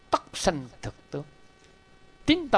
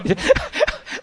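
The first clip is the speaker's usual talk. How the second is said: high laugh; 6 dB louder than usual. Real, not acted. Laughs at the old woman's prayer.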